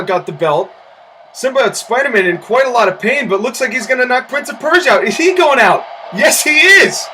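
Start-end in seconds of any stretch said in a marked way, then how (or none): 5.0-7.0 excited shout. Real, not acted. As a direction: shout the elimination. Excited call of the elimination.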